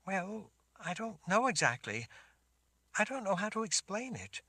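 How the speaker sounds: put-on high girl's voice, unsure; a young girl explaining hesitantly; shaken girl. Sammy struggles to explain.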